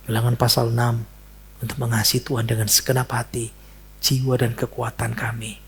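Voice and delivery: soft, quiet prayer voice; closing prayer. Acted. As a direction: lead the prayer gently. Memimpin doa penutup. (doa penutup yang lembut)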